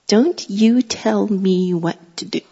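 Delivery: put-on slow, deliberate little-kid defiance; defiant toddler. Toddler daughter defying her mother over towels, defiant. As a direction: imitate a bossy toddler, word by word.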